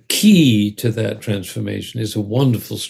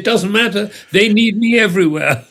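high, forceful mock grandeur; self-important maestro; 9 semitones higher, 3 dB louder than usual. Conductor answers his driver, comically self-important. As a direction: declare it high and urgent, full of ego.

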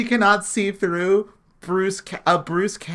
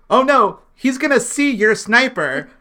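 loud, raised mock alarm; really loud; 4 semitones higher, 5 dB louder than usual. Sam loudly gives away Oksana's sniper, mock alarm. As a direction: announce it pointedly, as fake panic. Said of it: "Sam says really loudly".

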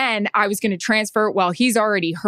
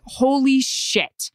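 holy shit; 5 semitones higher, much slower than usual. Retold or imitated. Panicked realising the mistake.

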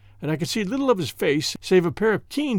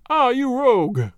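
high, slow, relishing exclamation; drawn-out, gleeful teasing, pitched up; highly delighted cry; 6 semitones higher, 4 dB louder, slower than usual. A narrator's reading. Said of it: "highly delighted".

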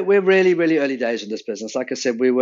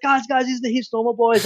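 excited calling out; 11 semitones higher than usual. Retold or imitated. Bartender calling colleagues over.

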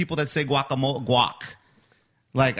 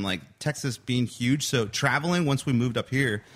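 normal talking voice

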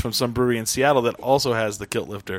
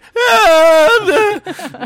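put-on drunken howl; 11 dB louder than usual. Drunk friend heckling band.

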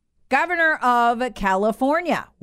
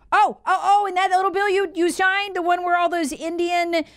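excited outburst; 9 semitones higher than usual. Real, not acted. Excited high outburst at governor.

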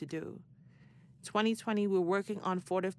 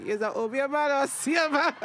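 laughing; 8 semitones higher, 5 dB louder than usual. Retold or imitated. Thinking it, cracking up.

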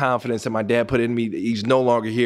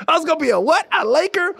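high, loud incredulous double take; can't believe he'd have been a Laker; stunned disbelief; 3 dB louder, faster than usual. Retold or imitated. Told he'd nearly been a Laker, incredulous and high. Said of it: "I'm like".

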